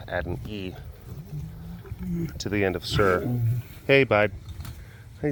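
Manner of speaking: ordinary delivery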